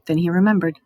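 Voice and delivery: usual speaking voice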